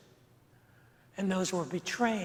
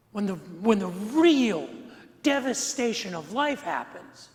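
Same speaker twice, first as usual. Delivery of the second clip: louder, impassioned preaching; 4 dB louder than usual. Acted. Pastor pleading with his congregation, impassioned preaching. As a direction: lean in and press the point.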